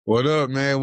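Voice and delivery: usual speaking voice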